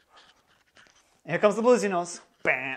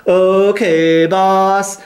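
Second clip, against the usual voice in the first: higher, held long "Okay, boss" on one note; flat three version; 6 semitones higher, 18 dB louder, much slower than usual. Shown as a demonstration. Flat three answering the queen on a held high note. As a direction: hold "Okay" high and steady, then "boss". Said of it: "since it's the flat three, it's more like".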